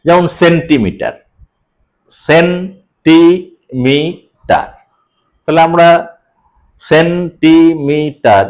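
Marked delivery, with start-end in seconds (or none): 2.3-3.4 centimetre drawn out slowly, syllable by syllable; slow syllable split. Shown as a demonstration. Teacher counting beats of centimetre, slow and split. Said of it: "এর এই শব্দটা যদি থাকে". 6.8-8.5 centimetre drawn out slowly, syllable by syllable; slow syllable split. Shown as a demonstration. Teacher counting beats of centimetre, slow and split. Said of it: "এর এই শব্দটা যদি থাকে".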